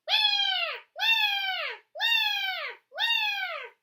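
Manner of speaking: high, loud wailing cry; baby crying wah. A narrator's reading. Lost baby wailing from the gorilla cage. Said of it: "from far away she heard".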